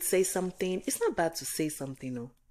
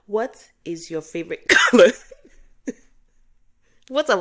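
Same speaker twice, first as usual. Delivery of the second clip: laughing; 5 semitones higher, 11 dB louder than usual. Real, not acted. Reading a typed listener question.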